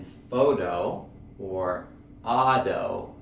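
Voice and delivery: American flap T in "photo" and "auto"; flap T demo. Shown as a demonstration. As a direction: say "photo or auto" as "fodo or ado". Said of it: "notice the D sound".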